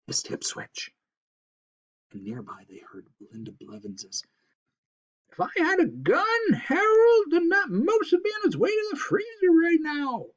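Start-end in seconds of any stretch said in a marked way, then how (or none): 5.3-10.3 much higher, loud griping voice; a crass woman griping to her husband; wife ranting at Harold; angry hunter wife. Acted. Belinda's shrill rant.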